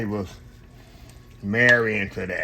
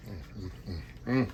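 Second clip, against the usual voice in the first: savoring hum; 3 semitones lower, 8 dB quieter than usual. Real, not acted. Tasting the hot pasta.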